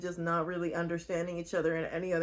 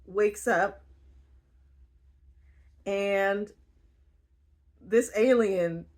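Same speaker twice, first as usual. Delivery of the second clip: ordinary delivery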